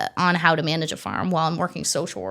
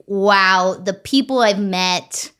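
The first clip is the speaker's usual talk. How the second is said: ordinary delivery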